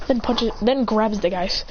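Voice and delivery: usual speaking voice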